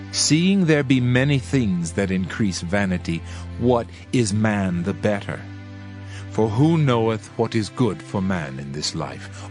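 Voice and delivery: normal talking voice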